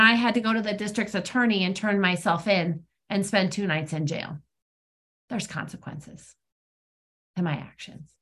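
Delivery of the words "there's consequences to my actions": hushed. Real, not acted. Sober point about jail time.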